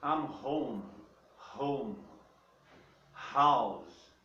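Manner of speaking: "home" and "house" start with a loud breathy H; breathy H sound. Shown as a demonstration. Teacher shows students the dry H sound. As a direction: push air out on the H of "house". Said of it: "Esse é um R mais seco".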